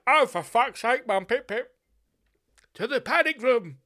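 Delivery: high, loud cheeky swearing; cockney kid. Pretend. Child reacting to the news, high and loud. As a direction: blurt it out high and loud like an urchin.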